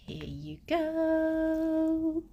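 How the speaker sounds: soft, high, very drawn-out; pet cooing. Real, not acted. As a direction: stretch it out gently and high for a pet. Handing something to the pet.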